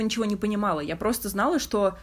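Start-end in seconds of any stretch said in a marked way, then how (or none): none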